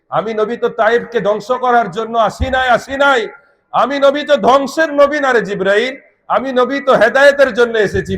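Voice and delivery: high, emphatic retold refusal; impassioned preaching. (উঁচু গলায় আবেগী উদ্ধৃতি)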